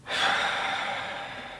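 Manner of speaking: long, soft exhale of grief; long sigh. Acted. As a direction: let the breath slowly drain out. Grief over daughter's unheard first cry.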